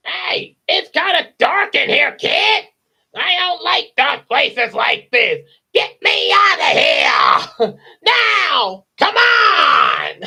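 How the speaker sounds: loud, very high screeching complaint; Gilbert Godfrey. Acted. Parrot stuck in dark chimney yells at kid, screechy comedian voice. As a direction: abrasive parrot shouting to be let out. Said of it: "Imagine if it was Gilbert Godfrey as the parrot".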